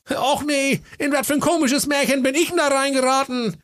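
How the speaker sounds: put-on high, goofy young prince; silly high prince voice. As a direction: play the dim prince, squeaky high. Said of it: "er sprach". (alberne hohe Prinzenstimme)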